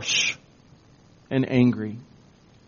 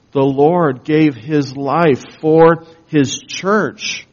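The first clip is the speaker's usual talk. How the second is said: normal talking voice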